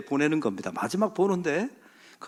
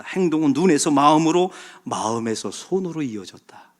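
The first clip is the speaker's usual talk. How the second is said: usual speaking voice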